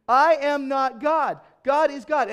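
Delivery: loud, slow, every word hammered; slow emphatic insistence. Retold or imitated. Preacher repeating to a stranger who calls him God, loud and slow. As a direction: spell it out word by word.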